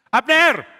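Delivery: high, loud shout; full-voiced summons; calling out. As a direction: holler the name to get attention. Daud kembali memanggil Abner.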